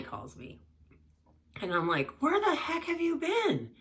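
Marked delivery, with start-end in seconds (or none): 2.2-3.7 exasperated mom. Retold or imitated. Mom finally gets the call.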